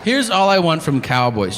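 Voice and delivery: ordinary talk, nothing marked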